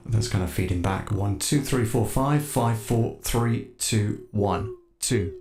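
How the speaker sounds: ordinary talk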